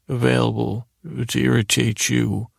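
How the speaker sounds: normal talking voice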